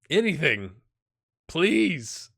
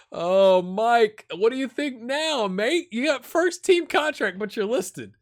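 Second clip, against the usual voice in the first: higher and louder, gleeful ribbing; teasing call-out; 10 semitones higher, 7 dB louder, slower than usual. Real, not acted. Gamer teasing a viewer who commented, loud and gleeful. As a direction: rib the viewer, voice up.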